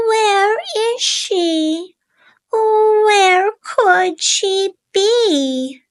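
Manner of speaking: high, worried chick, slowing down; a lost little bird fretting; worried baby bird. A narrator's reading. Baby bird wonders where his mother is, high and worried. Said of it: "he said".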